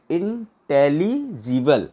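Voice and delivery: Intelligible very slow, in separated chunks; syllable by syllable. Shown as a demonstration. Teacher showing the syllables to learners, intelligible slowly in chunks. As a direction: break intelligible up with small gaps. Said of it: "इसका syllable भी देखो".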